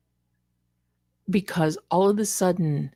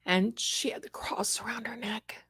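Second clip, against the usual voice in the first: hushed aside; whisper; 10 dB quieter than usual. Real, not acted. Vlogger confiding to viewers in a whisper. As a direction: drop to a secret whisper.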